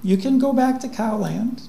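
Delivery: sweet and endlessly patient; gentle robot voice. Retold or imitated. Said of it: "This cow still kicks".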